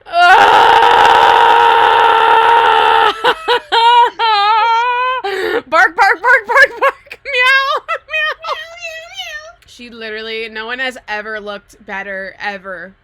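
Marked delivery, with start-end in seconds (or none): 0.1-5.6 gasping squeal. Real, not acted. Gasping over the Met Gala look. 5.7-7.2 playful barking. Pretend. Fangirling with animal noises. 7.2-9.6 playful meowing. Pretend. Fangirling with animal noises.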